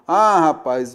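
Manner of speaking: ordinary talk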